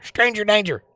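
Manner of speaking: very high, squealed playful warning; high mock alarm. Streamer escalating the stranger joke, high mock alarm.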